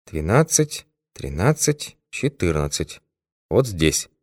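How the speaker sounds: hushed counting under the breath; whispering to himself. A narrator's reading. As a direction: count off room numbers softly to yourself. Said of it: "сказал он шёпотом про себя".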